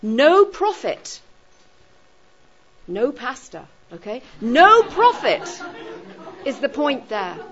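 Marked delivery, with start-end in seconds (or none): 0.0-1.2 "No" drawn out high and loud; stress on No. Shown as a demonstration. Teacher showing where the negative sits, heavy stress on "No". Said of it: "putting the negative emphasis right". 4.4-5.5 slow, high, loud "No"; stress on No. Shown as a demonstration. Teacher driving the negative home to students, heavy stress on "No". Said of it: "putting the negative emphasis right".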